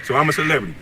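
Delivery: ordinary talk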